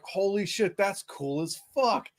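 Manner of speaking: retold wonder, drawn out; awed excitement. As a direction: relive the thrill of watching the bird rise. Retelling first pheasant flush.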